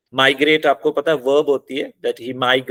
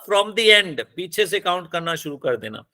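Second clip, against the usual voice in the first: usual speaking voice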